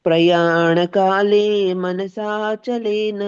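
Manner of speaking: chanted shloka with rising phrases; Sanskrit verse recitation. Acted. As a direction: intone the verse devotionally.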